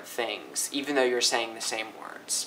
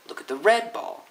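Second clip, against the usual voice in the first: RED pitched way up; contrastive stress on RED; 9 semitones higher than usual. Shown as a demonstration. Teacher recalls what she said, stressing RED. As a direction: hit "red" high, "ball" de-stressed. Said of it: "you guys were kinda thrown off".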